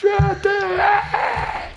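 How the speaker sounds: high, slow drawn-out wail; Anakin scream. Retold or imitated. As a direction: wail it like the Star Wars scene. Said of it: "Ok, Anakin".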